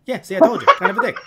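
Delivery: loud, high New York told-you-so; Grumble. Retold or imitated. Grumble confirming his verdict on Mondo, loud New York grouch. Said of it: "He has this New York accent".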